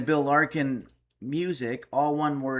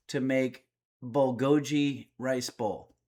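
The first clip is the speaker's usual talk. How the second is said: ordinary talk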